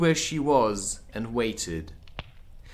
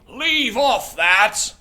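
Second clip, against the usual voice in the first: much higher, louder, slow shriek; screaming Queen; 11 semitones higher, 8 dB louder, much slower than usual. Acted. The Queen screams again, a bigger second take. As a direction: shriek it all out, far over the top. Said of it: "screamed the Queen".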